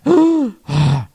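sharp loud gasp for air; dramatic gasp. Acted. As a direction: gasp like you're out of breath. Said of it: "I'll catch my breath".

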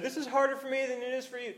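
high, quick quote of a parent; mock parent voice. Voices parents' stock line.

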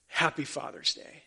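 ordinary delivery

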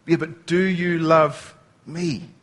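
slow, gentle "Yeah, but do you love me?"; personal, tender ask. Acted. Jesus gently pressing Peter, slow and tender. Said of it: "more the personal thing between them".

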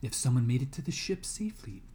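ordinary delivery, nothing marked

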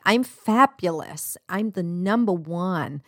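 slow, sickly-sweet American affirmation; cheesy American motivational voice, drawn out; American accent. Acted. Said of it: "sorry about the American accent".

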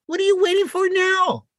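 fed-up nudge, pitch way up; exasperated urging. Real, not acted. Urging a shy dater to act.

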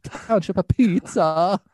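hammed-up Italian pizza chef accent. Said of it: "I have to do an Italian impersonation".

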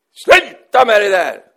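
loud, high, slow shout. Retold or imitated. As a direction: yell at the bird. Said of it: "då skrek han till". (Churchill skriker högt åt fågeln)

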